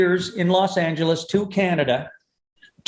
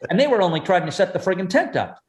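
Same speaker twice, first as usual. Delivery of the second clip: ordinary delivery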